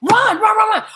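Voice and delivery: put-on calling the boys to flee; urgent call. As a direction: hurry them out, pushing.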